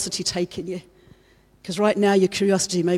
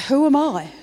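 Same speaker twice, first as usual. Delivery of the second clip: pitched up and louder; raised plea repeated; louder higher repeat; 7 semitones higher, 7 dB louder than usual. Retold or imitated. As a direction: ask it again with more force. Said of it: "He says it twice".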